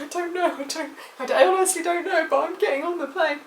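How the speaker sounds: overwhelmed, brushing off the questions; flustered. Retold or imitated.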